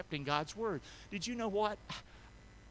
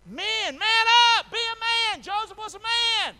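very high, very loud call to the men; preaching shout; 11 dB louder than usual. Real, not acted. Pastor rallying the men, in a full preaching shout. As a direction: bellow it from the pulpit.

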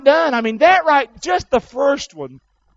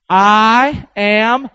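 loud congregational call; 2 semitones higher, 7 dB louder than usual. Shown as a demonstration. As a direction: lead the crowd in a loud I am. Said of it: "One more time".

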